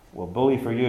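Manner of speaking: normal talking voice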